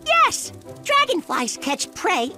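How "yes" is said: excited. Acted. Eager answer about hunting.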